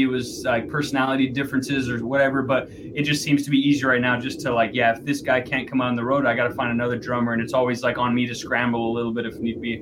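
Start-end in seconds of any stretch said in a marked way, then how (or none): none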